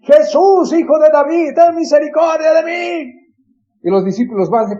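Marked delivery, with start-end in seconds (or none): 0.0-3.1 very high, loud, drawn-out plea; grito insistente. Acted. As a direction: shout again and again to be heard over the crowd. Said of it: "empieza a gritar una vez más". (clamor a gritos, agudo y alargado)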